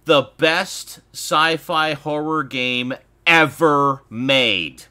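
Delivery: loud, slow passionate praise; emphatic. Real, not acted. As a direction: declare it loud and slow like a fan.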